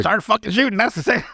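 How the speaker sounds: exasperated shout. Retold or imitated. Friend voices exasperated Oswald.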